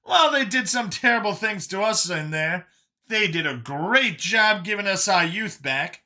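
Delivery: high, animated chatter; Brian, chatty rejuvenated survivor. Acted. Brian musing on getting his youth back, high and chatty. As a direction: rattle on high and upbeat.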